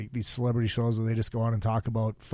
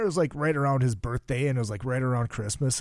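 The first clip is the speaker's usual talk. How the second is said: ordinary delivery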